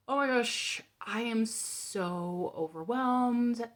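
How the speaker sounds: high, slow, strained overwhelm; overwhelmed panic. Retold or imitated. Alone in the car after the call, slow and overwhelmed. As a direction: let the panic spill out slowly.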